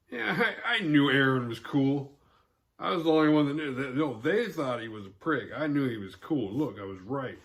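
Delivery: high, loud smug boasting, quickening; smug bragging. Retold or imitated. Mutant bragging to the audience, mock smug. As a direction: brag as if you knew it all along. Mute smugly claiming credit.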